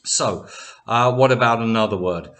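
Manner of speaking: normal talking voice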